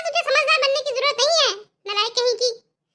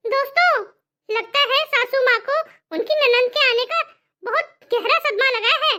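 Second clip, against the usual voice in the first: ordinary talk, nothing marked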